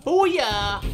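loud booyah yell; triumphant shout. Real, not acted. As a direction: shout it in victory. Triumphant strike.

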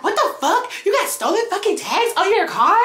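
high-pitched incredulous outrage; retold heated rant in a high voice; mad, indignant. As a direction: act out telling off a friend, each question flung upward. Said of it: "Like, I was so mad".

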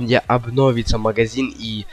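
ordinary talk, nothing marked

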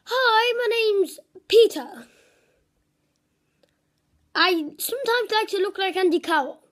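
a hint of a mate's voice; impression of friend Peter. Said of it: "So this is how he is".

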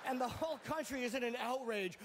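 ordinary delivery

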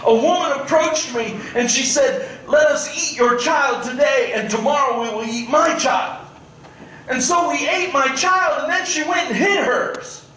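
high, desperate mother's plea to the king; pleading in tears. Retold or imitated. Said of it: "runs up to the king in tears".

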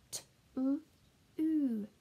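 "T-" then "ooh", held apart; short "T-", pause, long "ooh"; segmented phonics sounds for a learner; sounding out. Shown as a demonstration. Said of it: "if you can read these words".

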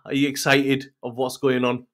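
usual speaking voice

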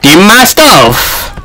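high, drawn-out gamer complaint; exasperated protest. Real, not acted. Gamer protesting to the opponent, high exasperated cries. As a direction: stretch the words in high-pitched outrage.

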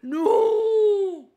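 loud gasp into a high drawn-out no; gasp, then wail in dismay; horrified gasp. Real, not acted. Player learning who she flirted with, horrified gasp and cry.

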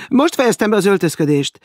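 loud, high, falsely breezy call; feigned briskness. A narrator's reading. Maud covers for the hidden man, falsely brisk. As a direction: call through the door, pretending all is well. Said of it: "felelte tettetett frissességgel a leány".

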